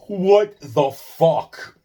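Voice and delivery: a long, loud cry of disbelief; drawn-out exasperated yell. Real, not acted. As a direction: stretch it out, loud and high in disbelief. Exasperated outburst, nobody names it.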